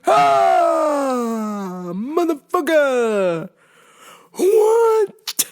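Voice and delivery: drawn-out frustrated cry; fed-up cry over the broken tailgate; exasperated outburst. Retold or imitated. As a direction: let out the frustration, then snap the question high. Venting at dad's news.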